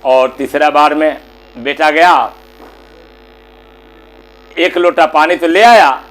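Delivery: usual speaking voice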